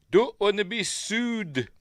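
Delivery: high, drawn-out comic warning; cartoon impression. Quoting a Simpsons gag, high comic impression. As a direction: stretch the last word up high.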